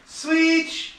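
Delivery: high, loud call. Real, not acted. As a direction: shout it across the garage. Calling out switch.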